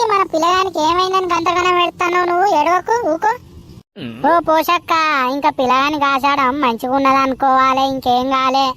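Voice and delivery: normal talking voice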